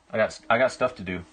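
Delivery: ordinary delivery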